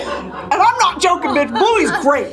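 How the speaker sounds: high, drawn-out gushing through laughter; excited raving. Real, not acted. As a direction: insist on it, high-pitched and laughing. Laughing enthusiasm over a cartoon.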